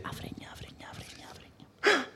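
drawn-out whisper, rising high; slow teasing whisper. Pretend. Whispering a crude joke word.

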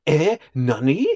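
high, rising, disbelieving yelps; overreacting straight man. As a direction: imitate a comic Japanese double take. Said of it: "he just reacts".

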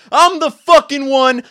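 high, loud, drawn-out yell; self-belief war cry. Acted. The delusional self-belief person facing fear, yelling. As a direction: yell it with total conviction. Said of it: "delusional self-belief person who throws his hands".

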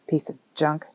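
low, soft grumble; grumbling. A narrator's reading. Man curses his old car's door, grumbling. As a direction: grumble under your breath as the man. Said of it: "Nick grumbled".

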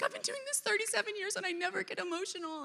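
a high, tearful quoted voice; crying nurse. Retold or imitated. Nurse overcome with tears, high and crying. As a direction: choke up as the teary nurse.

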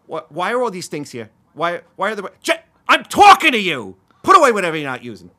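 loud, high-pitched exasperated telling-off; scolding shout. Acted. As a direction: yell at them to tidy up, building to full volume. Acts out scolding someone named Jep.

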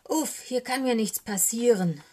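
loud, relieved raven croak. A narrator's reading. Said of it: "krächzte Socke".

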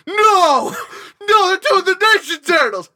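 high, loud, drawn-out cry of disbelief; re-enacted high-pitched freak-out; excited yelling. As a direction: yell it like an overexcited fan. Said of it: "I was, like, yelling".

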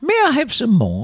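high pleading orphan voice; put-on British waif; Oliver. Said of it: "I'm thinking of Oliver".

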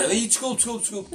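high, hurried mock apology; a lightly voiced panicky "desculpe"; mock flustered apology. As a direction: play the flustered caller backing off.

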